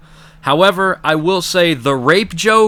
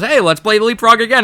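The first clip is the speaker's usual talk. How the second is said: lightly voiced, higher, eager SpongeBob; cheerful SpongeBob imitation; 8 semitones higher, 4 dB louder than usual. SpongeBob inviting Gary to play, mild mock eager voice. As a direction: chirp it up like a playful kid. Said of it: "and he goes".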